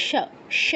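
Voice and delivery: "স" as palatal sha; isolated sha syllable for "স"; palatal sibilant. Shown as a demonstration. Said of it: "তালব্য স উচ্চারণ হচ্ছে".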